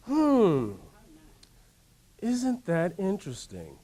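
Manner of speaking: slow wondering "Hmm", then an unhurried question; curious musing. Shown as a demonstration. Modelling calm curiosity after being cut off, slow and musing. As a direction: muse on it instead of getting mad. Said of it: "Say, "Hmm".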